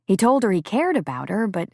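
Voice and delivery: plain reading voice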